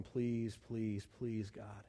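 hushed, low plea to God; serious pleading prayer. Acted. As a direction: beg softly, fading almost away. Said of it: "Sometimes it's more serious".

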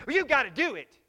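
put-on high, loud yelling kid fed up; angry sibling shout. Sibling quarrel acted out.

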